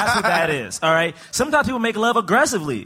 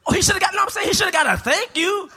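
defensive shout; 9 semitones higher than usual. Real, not acted. To cohost who mocks his point, defensive shout.

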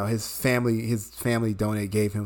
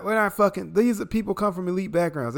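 ordinary talk, nothing marked

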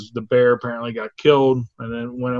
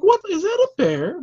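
very high squeaky disbelief; shocked disbelief; 3 dB louder than usual. Retold or imitated. Telling a friend about seeing the bear, squeaky shock. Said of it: "you're just like".

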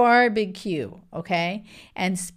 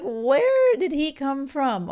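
higher and slow, with a mock-amazed lilt; higher, slowed-down disbelief; incredulous; 6 semitones higher, slower than usual. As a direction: act stunned that he even exists. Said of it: "I'm like".

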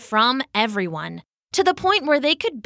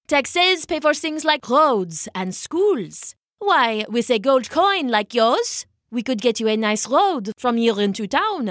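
put-on persuasive French-accented taxman; 3 semitones higher than usual. Taxman's sales pitch.

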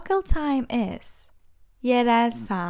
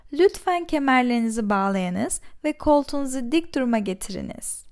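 fast; faster than usual. Shown as a demonstration. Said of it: "repeated slowly".

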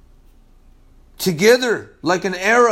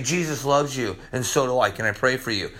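much softer and lower, tender offer; gentle invitation; 9 semitones lower, 7 dB quieter, faster than usual. Pretend. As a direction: say it softly, like offering comfort to a stranger. Acting out a tender street witness.

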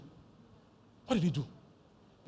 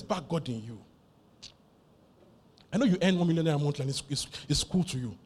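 normal talking voice

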